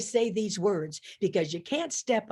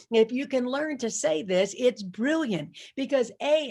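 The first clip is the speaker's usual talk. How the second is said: normal talking voice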